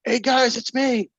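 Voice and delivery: high, loud frantic yelling; frightened high shouting that turns to confusion; panicked yelling. Acted. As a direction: yell in rising panic as it closes in. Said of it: "Then you hear H yelling".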